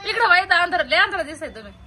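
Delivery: excited. Real, not acted. Teasing about smoke from ears.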